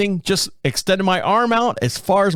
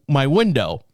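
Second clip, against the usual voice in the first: ordinary delivery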